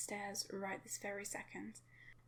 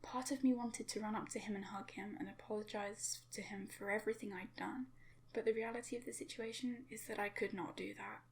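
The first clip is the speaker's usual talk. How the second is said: plain reading voice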